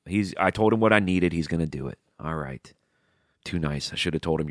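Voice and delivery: ordinary talk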